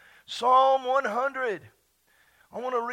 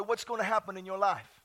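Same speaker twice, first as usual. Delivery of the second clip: usual speaking voice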